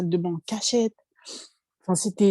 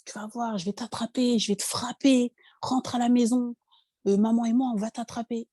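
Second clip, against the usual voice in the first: threatening brother; 4 semitones higher than usual. Retold or imitated. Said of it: "il était vulgaire et tout".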